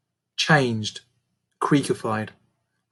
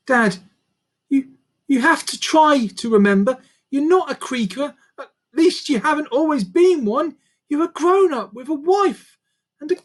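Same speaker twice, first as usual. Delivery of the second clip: put-on much higher young girl's voice, urgent; pleading girl; 13 semitones higher, 4 dB louder than usual. A narrator's reading. Daughter pleads with her changed dad, higher girl's voice. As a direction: beg him to know you.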